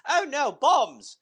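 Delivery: put-on slow, high, loud old-lady alarm; mock grandmother panic. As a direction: play the granny, startled by bombs. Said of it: "my grandmother was just like".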